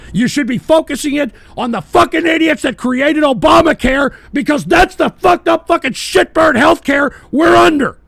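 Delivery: loud, high-pitched furious tirade building to a shout; angry rant. Real, not acted. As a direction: yell it out in full fury. Ranting at Obamacare creators.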